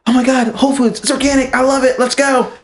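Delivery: high-pitched gushing enthusiasm, imitating a friend; mock excitement. Retelling a friend's reaction to Whole Foods, excited mimicry. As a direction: gush in a high put-on voice.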